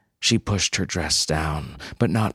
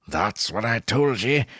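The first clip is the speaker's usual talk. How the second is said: higher-pitched little Scotsman; Scottish brogue; 4 semitones higher than usual. A narrator's reading. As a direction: say it as a vindicated little Scot. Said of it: "said her little man in a boat".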